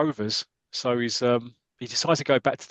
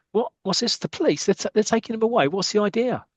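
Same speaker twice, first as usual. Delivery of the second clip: higher, rising alarm; Roy alarmed as police take Blackie; alarmed disbelief; 8 semitones higher than usual. Acted. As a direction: protest in disbelief as his friend is taken away.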